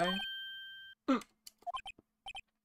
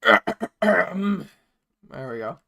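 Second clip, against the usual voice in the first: ordinary delivery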